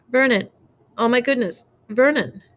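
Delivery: high-pitched alarmed exclamations; shocked outcry. A narrator's reading. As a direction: cry out in dismay, high. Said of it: "choked out a noise".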